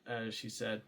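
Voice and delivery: ordinary talk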